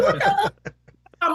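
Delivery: high, long drawn-out outburst with laughter; astonished disbelief. Retold or imitated. Hairdresser watching [name] disappear, comic astonishment.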